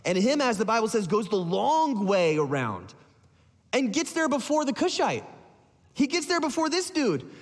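ordinary talk, nothing marked

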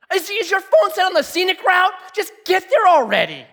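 loud, high exasperated yelling at drivers; road rage rant. Retold or imitated. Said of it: "I'm like".

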